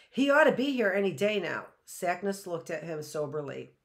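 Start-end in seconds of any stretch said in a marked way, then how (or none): 0.1-1.7 fast, louder and raised; quickly. A narrator's reading. Matt hurries to answer the old man, fast. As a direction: rush it out to cover the fear. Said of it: "Matt spoke quickly".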